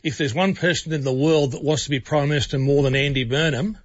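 ordinary talk

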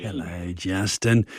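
put-on old man greeting, low and soft; creaky elderly grandpa hello. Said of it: "You sound like a, uh, a grandpa when you said that".

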